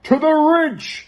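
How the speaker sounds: high, slow booming proclamation; walrus proclaiming. Acted. Walrus proclaiming to Wilf, grand and booming. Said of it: "he proclaimed".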